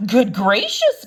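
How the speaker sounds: loud startled gasp; Maisie's shocked gasp. A narrator's reading. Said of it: "gasped Maisie".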